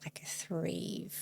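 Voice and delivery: soft sigh on the words. Real, not acted. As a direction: let it out on a breath. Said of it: "the reason I'm sighing like that".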